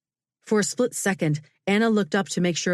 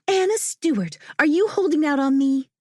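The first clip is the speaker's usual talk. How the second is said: put-on excited young friend; 10 semitones higher, 3 dB louder than usual. A narrator's reading. Friend's excited teasing accusation.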